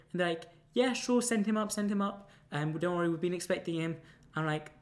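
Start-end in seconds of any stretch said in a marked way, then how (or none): 0.7-4.0 higher, chirpy voice of the woman on the phone; breezy phone woman. Retold or imitated. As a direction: play the cheerful madam inviting him up.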